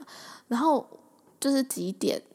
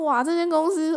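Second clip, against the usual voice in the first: amazed; 7 semitones higher, 4 dB louder than usual. Retold or imitated. Amazed at messy office.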